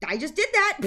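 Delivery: high, loud quip; mock deadpan. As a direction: toss it off, amused at yourself. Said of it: "and I'm like".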